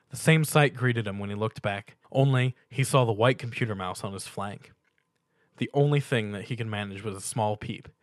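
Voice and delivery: ordinary delivery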